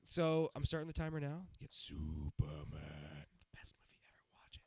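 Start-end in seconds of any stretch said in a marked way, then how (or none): 1.6-4.6 low whisper. Acted. Hyping his favorite movie.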